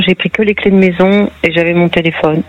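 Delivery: normal talking voice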